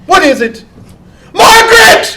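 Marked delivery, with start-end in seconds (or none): 1.3-2.2 high calling shout. Acted. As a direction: hail her across the room. Guru calls out to fan.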